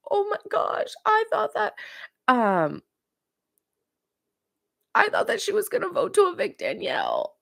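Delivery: high, mock-upset Brittany voice; Brittany impression. Vlogger mimics Brittany's shocked reaction at the round table, high mock-distress voice. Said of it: "Brittany is like".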